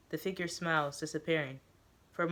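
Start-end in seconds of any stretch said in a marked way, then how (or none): none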